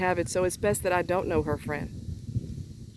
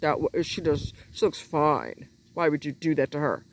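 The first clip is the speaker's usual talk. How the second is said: mocking stupid remark; 5 semitones lower than usual. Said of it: "don't fucking say nothing stupid like".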